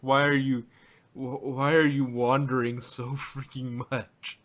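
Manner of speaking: usual speaking voice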